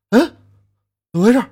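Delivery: jolted awake and demanding; startled question. Acted. Father wakes to crying. (惊醒后大声发问)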